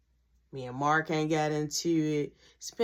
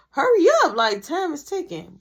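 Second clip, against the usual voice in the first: impatient; 9 semitones higher, 9 dB louder than usual. Retold or imitated. Impatient call to friend.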